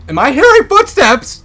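high, very loud alarmed question; real startled high-pitched yell; panicked yell. Real, not acted. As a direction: shriek the question in sudden alarm. Startled by sounds in horror game.

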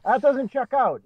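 high British heckler voice, lightly done; a hint of a pointed British heckler; British accent heckle. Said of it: "yelled out in a British accent".

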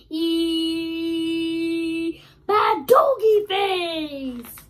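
loud, slow gibberish, building to a wailing cry; hammed-up nonsense ending in a mock crying shout; silly sounds. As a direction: spit out nonsense noises with full goofiness. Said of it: "make silly sounds like".

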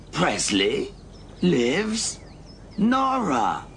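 word by word, with suspenseful pauses; dramatic reveal. Acted. Alf reveals his anagram with suspense. (bedeutungsvolle Enthüllung mit Kunstpausen)

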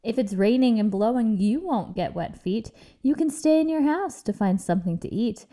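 usual speaking voice

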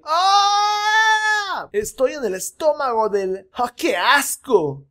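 high cry, then slow grossed-out whine; hammed-up disgust; disgusted hero. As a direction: complain from inside the stomach. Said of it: "Y Will".

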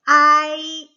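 slow, loud, high 아이; drawn-out model syllable. Shown as a demonstration. Teacher modelling 아이 slowly and loudly. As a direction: stretch out 아이 as a model. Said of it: "팔 번".

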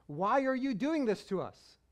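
a mother's exasperated demand; mother confronting her son after searching for him; full scolding mom. Retold or imitated. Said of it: "like when mom says your full name".

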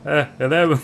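Eleven in a mock Irish accent. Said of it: "У ирландцев там акцент такой".

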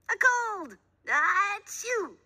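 high loud cry and a comic sneeze; punchline then sneeze. A narrator's reading. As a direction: shout the answer then sneeze.

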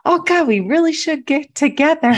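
high, loud fake-friendly gush; mock grocery-store greeting. Bumping into someone at the store, fake-friendly gushing. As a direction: gush it like an empty promise. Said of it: "you're like".

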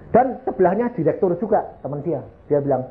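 ordinary talk

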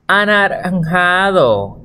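slow, stretched "anaranjado"; drawn-out answer. Shown as a demonstration. Teacher reveals the colour to pupils, stretched out. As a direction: draw out "anaranjado" syllable by syllable.

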